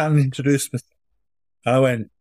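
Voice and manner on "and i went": ordinary talk, nothing marked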